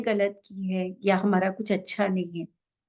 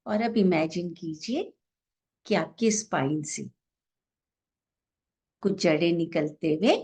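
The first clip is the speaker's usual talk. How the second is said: guided meditation; 3 semitones lower than usual. Real, not acted. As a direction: guide each breath gently, drawing out the exhale cues. Leads a breathing meditation.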